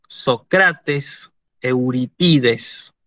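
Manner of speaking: "Sócrates, Euripides" said so-CRA-tes, eu-ri-PI-des; Greek penultimate stress. Shown as a demonstration. Teacher gives Greek "Sócrates, Euripides" as so-CRA-tes, eu-ri-PI-des, penultimate stress. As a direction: stress next-to-last syllables in "Sócrates, Euripides", deliberately. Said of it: "en griego se dice".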